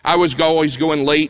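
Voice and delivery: ordinary delivery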